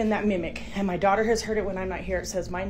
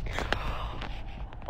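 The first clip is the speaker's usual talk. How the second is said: gasp; 8 dB quieter than usual. Real, not acted. Frightened by noise upstairs.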